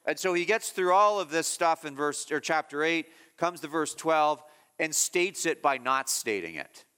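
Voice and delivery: usual speaking voice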